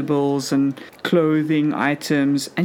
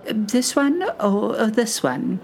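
put-on very old woman; 4 semitones higher than usual. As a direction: croon it like a sweet granny. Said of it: "a very old lady".

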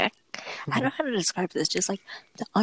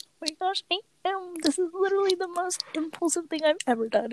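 bubbling, much higher pitched, fast; excited; 11 semitones higher, faster than usual. Retold or imitated. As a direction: gush like you just can't contain it. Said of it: "you sound so happy and excited".

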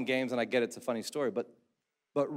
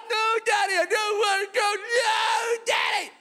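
put-on much higher, louder, fast child's shriek; shrieking little girl; 3 dB louder than usual. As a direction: wail no like a panicked little girl. Daughters shrieking back.

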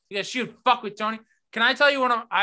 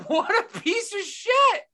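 high, slow, laughing outrage; laughing disgust; 11 semitones higher, slower than usual. Real, not acted. A friend laughing in disgust at Rudy's brother. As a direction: drag it out through a laugh.

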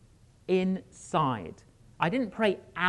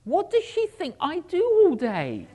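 sharp high indignant thought, louder; indignant inner thought; 10 semitones higher, 5 dB louder than usual. Retold or imitated. Inner voice to himself about Barbara, indignant. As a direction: bristle at being asked to do your own job. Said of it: "And I think".

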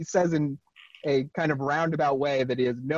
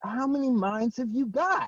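put-on Paul's New York accent; 8 semitones higher than usual. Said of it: "I have to hear it in, like, a New York accent".

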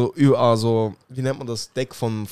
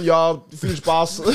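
slow, laughing mock welcome; much slower than usual. Imitating locals who wave tourists in, slow and laughing. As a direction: say it like a grinning con man. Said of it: "und sagen dann".

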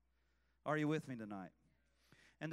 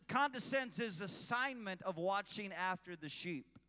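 usual speaking voice

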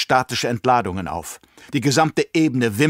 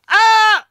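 sudden pained cry; 9 dB louder than usual. Acted. Baron is stabbed by needle.

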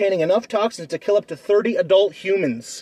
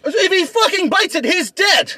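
excited shout; 10 semitones higher than usual. Real, not acted. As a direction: yell it in triumph. Heated animal fight argument.

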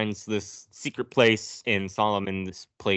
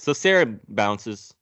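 usual speaking voice

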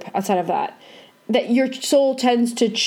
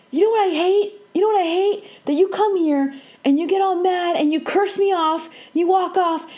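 higher, exasperated mom venting; mom complaining; 8 semitones higher than usual. Retold or imitated. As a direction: mimic an upset mother airing grievances, higher pitch. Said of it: "Mom used to get mad when she would say".

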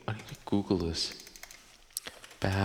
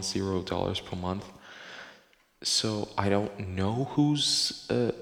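ordinary talk

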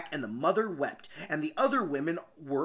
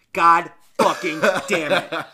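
laughing; 8 dB louder than usual. Real, not acted. Laughing frustration at misreading.

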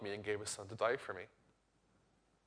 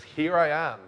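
louder, higher call to announce yourself; sudden announcement; 4 semitones higher, 9 dB louder, slower than usual. Acted. God jumping in front of the Gentiles, sudden announcement. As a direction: burst out with a bold greeting.

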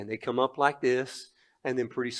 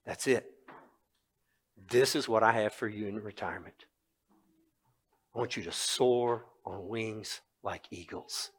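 quiet; 3 semitones lower, 6 dB quieter than usual. Retold or imitated. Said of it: "it's like God said to me".

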